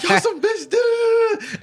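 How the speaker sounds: high, laughing, incredulous squeal; high-pitched outburst. Retold or imitated. Player retelling a game to friends, high-pitched outburst. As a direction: re-enact a heated outburst, voice shooting up high. Acted teammate outburst in a story.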